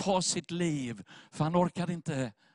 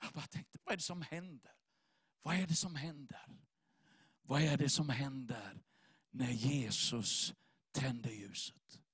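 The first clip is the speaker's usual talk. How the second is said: very soft, slowing and sinking lower; hushed wonder; 5 semitones lower, 10 dB quieter than usual. Real, not acted. Preacher wondering what happens when Jesus lights the light, hushed. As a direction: drop almost to a murmur, pondering.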